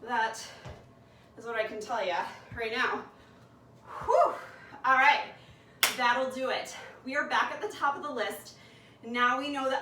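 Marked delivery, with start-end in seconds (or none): 4.0-4.3 big loud high whoosh of breath; loud tired exhale. Real, not acted. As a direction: blow out hard after the set. End of a hard exercise set.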